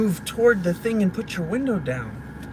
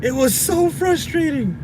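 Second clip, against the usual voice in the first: frustrated; 8 semitones higher than usual. Real, not acted. Worn out by the big yard, frustrated. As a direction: repeat it high, with the force drained. Softer repeat of the complaint.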